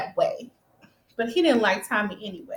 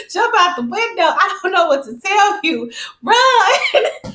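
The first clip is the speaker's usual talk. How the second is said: high excited yelling at a TV character; mock urgent plea; 8 semitones higher, 4 dB louder than usual. Fan telling Proctor to escape, mock urgent plea. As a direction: urge him to flee, rising to a laughing "Run".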